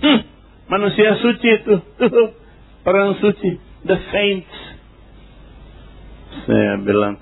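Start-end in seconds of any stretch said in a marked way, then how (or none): 0.0-4.6 mocking gossip. People mocking him as saint.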